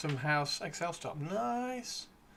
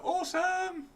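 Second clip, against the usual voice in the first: squeaky awesome; slower than usual. Pretend. Reacting to chat.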